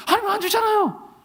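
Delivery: whiny complaint. Retold or imitated. Pastor complaining to God that he never gives, whiny and high. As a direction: pitch it up like a sulking child. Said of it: "제가 그랬어요".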